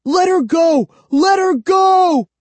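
high, loud cry of alarm; desperate scream. A narrator's reading. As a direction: scream it, stretching the last word. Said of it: "I screamed".

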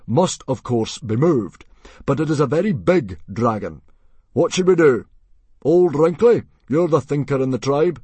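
booming chief seeking counsel; commanding chief Stoic. A narrator's reading. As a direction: appeal to the tribe at full voice. Plays Stoic addressing the tribe.

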